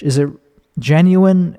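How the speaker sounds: ordinary delivery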